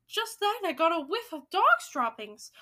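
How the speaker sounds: high, loud excited report; yell. Acted. Witch telling the others what she smelled, yelling. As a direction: keep yelling the news, pitch up. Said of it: "she yelled".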